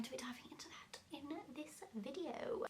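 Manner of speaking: hushed, whispery teaser; soft conspiratorial whisper to the viewer; whispered aside. Pretend. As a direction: drop into a whisper, as if sharing a secret.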